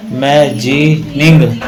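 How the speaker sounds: Imagining slowly split as "ma-jee-ning"; syllable by syllable. Shown as a demonstration. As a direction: sound out imagining in separate, slow chunks. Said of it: "तीन syllable है". (Imagining को धीरे-धीरे तोड़कर बोलें)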